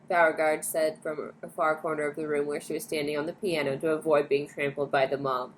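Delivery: ordinary delivery, nothing marked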